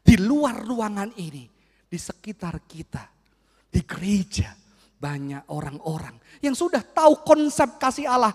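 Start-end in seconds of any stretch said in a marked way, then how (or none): 3.7-6.1 whisper. Real, not acted. Sermon whisper aside.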